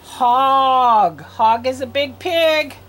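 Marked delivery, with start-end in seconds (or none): 0.0-1.2 Hog stretched long and loud; emphatic, held Hog; word sounded out. Shown as a demonstration. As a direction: draw out the og in Hog. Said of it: "we're gonna do these words first".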